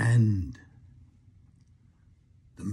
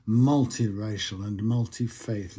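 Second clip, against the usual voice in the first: ordinary delivery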